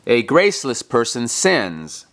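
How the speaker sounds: ordinary talk, nothing marked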